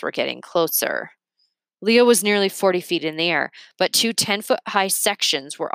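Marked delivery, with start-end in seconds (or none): none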